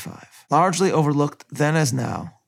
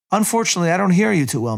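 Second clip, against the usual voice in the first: louder and higher, as if shouting down a crackly phone line; raised voice on bad line; 6 semitones higher, 4 dB louder, faster than usual. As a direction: push the voice to reach a listener who can't hear. Ford straining on bad line.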